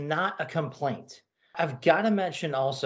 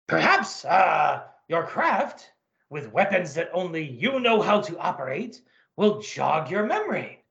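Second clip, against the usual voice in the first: put-on gruff German officer, German accent; comic-book Nazi colonel, German accent; 3 semitones higher than usual. Colonel speaks.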